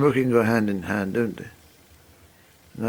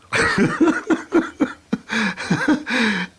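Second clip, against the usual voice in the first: long high laugh; 12 semitones higher than usual. Real, not acted. Laughs after "Charming.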